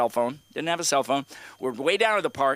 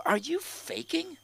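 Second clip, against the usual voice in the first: suspicious question; 8 dB quieter, slower than usual. Retold or imitated. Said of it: "So I go".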